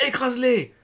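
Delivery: high, loud urgent command; panicked shouted instruction; shouted order. A narrator's reading. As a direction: yell it over your shoulder. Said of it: "lui cria-t-il par-dessus l'épaule".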